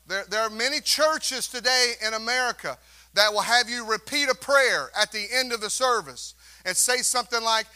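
ordinary talk

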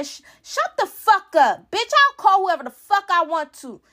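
high, heated retort; angry telling-off. Retold or imitated. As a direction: snap back in a replayed quarrel. Retold telling-off during family quarrel.

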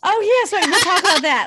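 high, loud gasp then eager chatter; gasp, then bubble over with delight; excited gasp. Real, not acted. Teller hears her friend's big news, gasps with excitement.

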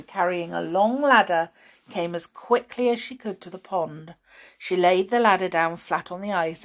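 ordinary delivery, nothing marked

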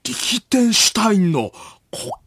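slow, deliberate know-it-all musing; 伊集院光. Acted. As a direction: muse aloud with drawn-out emphasis. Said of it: "伊集院光さんが". (もったいぶった伊集院のものまね)

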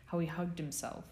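usual speaking voice

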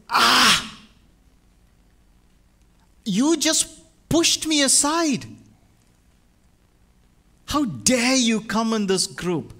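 high, loud, shocked outcry of a scandalised crowd; outraged crowd. Acted. The crowd recoils at the woman who touched them, high loud outrage. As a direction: gasp and then lash out, how dare you. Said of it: "feel the whole crowd gasp and say".

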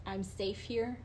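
quietly imitating a victim comforting herself; retold words said softly to oneself; self-soothing murmur. Hospitalized victim repeating to herself for comfort, quiet murmur. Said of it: "just kept repeating to herself".

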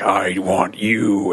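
hammed-up Grim Reaper summons; Death from a comedy sketch. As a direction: intone it slowly as Death. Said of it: "Death shows up and he's like".

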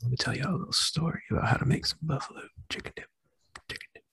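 breathy seductive whisper; sultry whisper. Acted. Sultry cooking instruction bit.

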